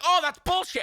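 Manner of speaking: high, loud fake gamer outburst; hammed-up gamer rage yell; mock rage. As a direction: snap at the game in mock fury. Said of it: "and then be like".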